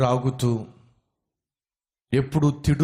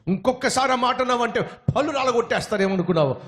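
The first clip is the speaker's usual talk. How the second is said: angry threat; 8 semitones higher than usual. Acted. Angry man threatens again.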